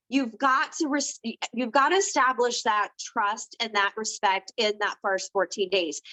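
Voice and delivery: usual speaking voice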